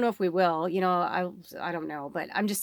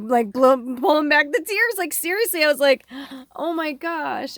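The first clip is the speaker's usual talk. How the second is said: high, louder, tearful laughing; emotional; 6 semitones higher, 6 dB louder than usual. Real, not acted. Reader overwhelmed by the song, holding back tears. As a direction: fight back real tears. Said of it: "I'm starting to get emotional".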